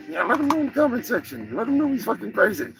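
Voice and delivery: a cocky cartoon turkey boasting; turkey character.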